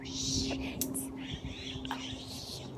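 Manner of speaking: hushed, voiceless whisper; whispered swearing. Real, not acted. Vlogger trying not to be noticed, swearing in a whisper. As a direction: curse under your breath. Sneaking, avoiding being noticed.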